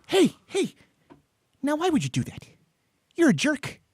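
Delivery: high-pitched, indignant imitation; Tom Cruise. Star scolds reporter who squirted water, high-pitched mock scolding. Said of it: "when Tom Cruise did that with the reporter".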